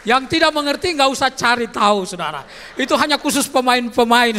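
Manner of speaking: loud, high fervent preaching; preaching at full force. Real, not acted. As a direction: drive it home to the church.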